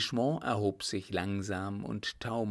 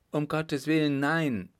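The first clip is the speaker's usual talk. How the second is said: alarmed plea; 6 semitones higher, 4 dB louder than usual. Acted. Second soldier objects in alarm.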